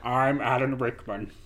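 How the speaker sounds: lightly voiced posh English baddie; a hint of Hans Gruber; Alan Rickman impression. Shown as a demonstration. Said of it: "That was my Alan Rickman impression".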